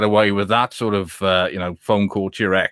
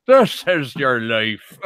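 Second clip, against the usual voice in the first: presenter impression; 3 semitones higher, much slower than usual. Mocking Eamon Andrews presenter voice.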